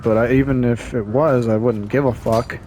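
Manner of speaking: ordinary talk, nothing marked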